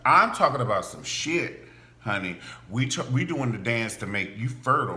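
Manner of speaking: usual speaking voice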